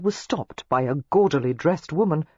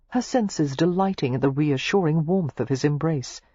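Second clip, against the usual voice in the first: plain reading voice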